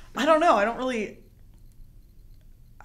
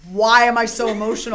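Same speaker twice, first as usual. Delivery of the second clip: mock bewildered outburst; 3 semitones higher, 13 dB louder than usual. Acted confused self in story.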